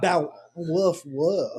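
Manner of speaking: lightly voiced woof woof; a playful dog impression; mock dog bark. Shown as a demonstration. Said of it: "you gotta go like".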